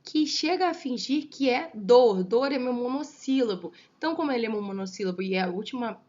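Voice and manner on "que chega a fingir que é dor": loud, slow verse reading with linked vowels; slow verse demonstration. Shown as a demonstration. Teacher reading the verse aloud for scansion, slow demonstration. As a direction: declaim the line slowly, beat by beat. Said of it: "pensa na sonoridade".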